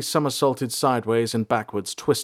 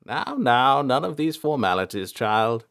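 kindly elder; 3 semitones higher than usual. A narrator's reading. Elder waves away the bows.

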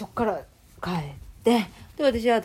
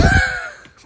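burst of laughter; 8 dB louder than usual. Real, not acted. Laughing at granny's answer.

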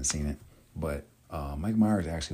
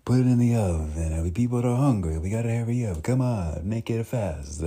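put-on loud, higher bustling Italian accent; Italian chef accent; 5 semitones higher, 9 dB louder than usual. Italian chef rushing his assistants with the pizza, Italian accent. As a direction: hurry the kitchen like a busy Italian chef. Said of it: "other accents I like to do is, um, that are Italian".

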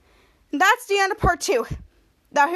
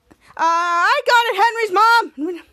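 stalling lie; 4 semitones higher, 6 dB louder, much slower than usual. Acted. Ray making up a lie.

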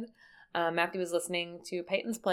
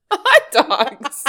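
laughing; 6 semitones higher, 15 dB louder than usual. Real, not acted. Laughing at joke.

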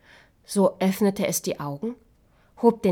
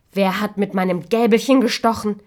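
louder grumbling demand; loud indignant question; 2 semitones higher, 7 dB louder than usual. Acted. Fifth dwarf checking his fork, loud and cross. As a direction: call it out crossly.

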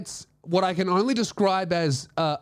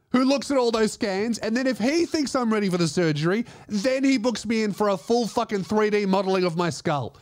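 usual speaking voice

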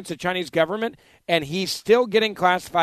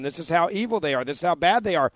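ordinary delivery

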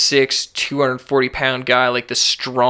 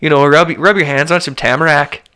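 hammed-up Canadian northerner, lilting and louder; Canadian accent; 2 semitones higher, 4 dB louder than usual. Podcast host teasing his guest from Prince Edward Island in a hammed-up Canadian accent. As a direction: put on a rural Canadian accent. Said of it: "lost that accent".

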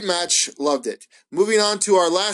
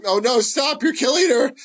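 high-pitched, hammed-up alarm; overacted fan begging for mercy; mock panicked plea; 10 semitones higher, 3 dB louder than usual. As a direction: plead in exaggerated distress, pitch climbing. Acting out his panicked reaction.

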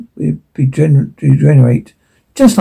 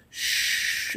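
hushing whisper; 19 dB quieter than usual. Acted. Mother hushes her baby.

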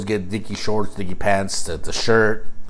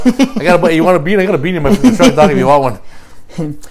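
excited; 5 semitones higher, 6 dB louder, faster than usual. Acted. Offering beanies, hyped.

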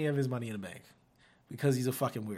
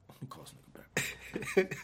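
whispered aside; 4 dB quieter than usual. Retold or imitated. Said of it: "My dad was like".

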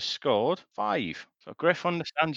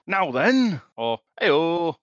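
Yorkshire accent; 4 semitones higher, 5 dB louder, much slower than usual. Shown as a demonstration. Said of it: "when you do the Yorkshire bit".

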